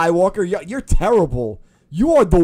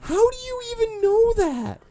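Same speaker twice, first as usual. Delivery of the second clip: very high-pitched disbelief; incredulous outburst. Real, not acted. Viewer heckling the game's character, high incredulous outburst. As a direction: demand it in high, strained disbelief.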